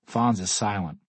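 ordinary delivery

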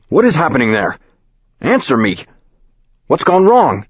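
high, loud demanding yell. Acted. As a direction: shout up high, demanding an answer. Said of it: "The speakerphone is yelling".

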